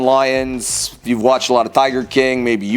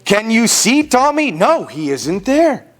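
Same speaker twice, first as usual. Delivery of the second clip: animated, theatrical picture-book reading; children's story narration; 5 semitones higher than usual. A narrator's reading. Storyteller reading a parade book to children, animated narration. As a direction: read it out with exaggerated swings of pitch.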